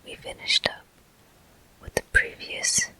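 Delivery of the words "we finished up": ordinary talk, nothing marked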